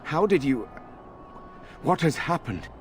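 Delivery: jolted by seeing her alive; brother-in-law startled, questions tumbling out; startled disbelief. Acted. Finds sister-in-law alive unexpectedly.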